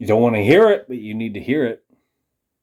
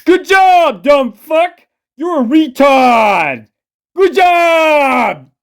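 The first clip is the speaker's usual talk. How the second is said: much higher, louder mocking yell; mocking fan shout; 13 semitones higher, 6 dB louder, much slower than usual. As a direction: yell it like a gloating commenter cheering you on. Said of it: "You want me to fucking say".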